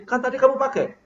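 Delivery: high, pointed accusation; accusing retort, pitched well up. Shown as a demonstration. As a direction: pin the blame on the listener. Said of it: "Bapak nuduh".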